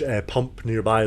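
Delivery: ordinary delivery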